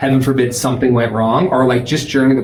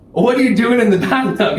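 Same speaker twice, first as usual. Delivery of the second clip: startled question; 8 semitones higher than usual. Retold or imitated. Confronting husband hidden in tub.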